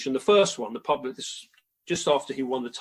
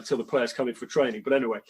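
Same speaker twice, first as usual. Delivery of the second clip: ordinary talk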